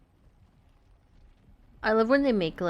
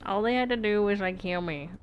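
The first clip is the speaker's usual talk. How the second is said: usual speaking voice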